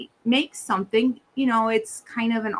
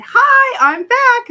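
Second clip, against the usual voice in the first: much higher, much louder, bright sing-song hi; cheery greeting; 14 semitones higher, 11 dB louder, much slower than usual. Retold or imitated. Returning to the shop lady, high and loud mock-cheery hello. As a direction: burst back in with mock delight. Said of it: "I said".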